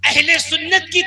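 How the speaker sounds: loud, high, ringing sermon delivery; preaching at full force. Acted. Preacher rousing the congregation, loud full-force preaching. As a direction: preach it out over the gathering.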